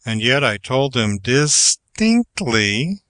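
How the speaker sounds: "distinctly" slow, swooping up, down and up again; inflection on last syllables. Shown as a demonstration. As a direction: wind the pitch through "distinctly" to a rising end. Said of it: "making no modulation until you come to the last two syllables".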